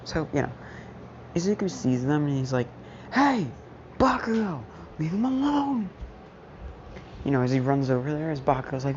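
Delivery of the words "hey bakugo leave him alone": heroic call-out. Retold or imitated. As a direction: call out bravely across the yard, pitched high. Hero calls out to bully.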